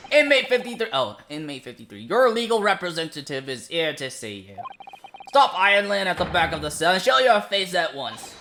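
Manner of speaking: loud, commanding call into the cell; stern call. Acted. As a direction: summon the prisoner sharply. Guard calls out to the prisoner.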